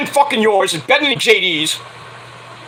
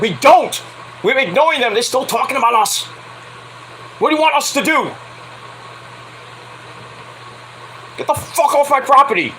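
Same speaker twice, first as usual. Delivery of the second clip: retold indignant protest; 4 semitones higher than usual. Retold protest to police officer.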